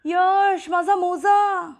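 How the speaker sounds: high, drawn-out call, slow; whining call. Acted. Sister calls Yash for her sock, slow and whiny. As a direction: stretch मोजा as you call to him.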